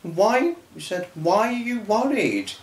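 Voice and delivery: a hint of Michelle's surprised voice; imitating Michelle. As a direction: lightly voice her asking why he apologises. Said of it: "she was like".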